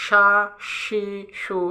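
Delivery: সা, সি, সু as sha, shi, shu; sh from mid-tongue. Shown as a demonstration. Teacher giving learners shin to practise, mid-tongue sh. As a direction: make সা, সি, সু hush from the middle of the tongue. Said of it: "এই সাউন্ডটা হবে কি জিহ্বার মাঝখান থেকে".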